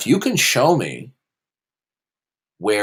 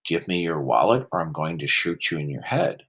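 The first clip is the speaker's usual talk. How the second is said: mock-polite robber; 7 semitones lower, 5 dB quieter than usual. Debater acting a courteous gunman making his point, mock-polite. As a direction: make a threat as courteous as can be. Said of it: "Somebody could politely say".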